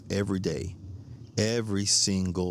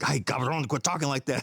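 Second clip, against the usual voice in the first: grandma scolding; 6 semitones higher than usual. Retold or imitated.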